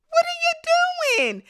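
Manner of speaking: high, loud, slow outraged cry; shocked shout. Retold or imitated. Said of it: "And everybody is".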